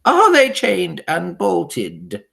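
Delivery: slow, menacing witch question; cold interrogating witch; harsh witch voice. A narrator's reading. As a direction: draw out the threat. Grand High Witch questions her audience.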